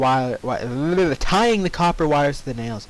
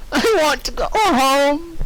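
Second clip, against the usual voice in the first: high, slow, louder sobbing plea; whimpering plea; 13 semitones higher, 4 dB louder, slower than usual. Acted. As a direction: beg to go home. Dash crying to go home.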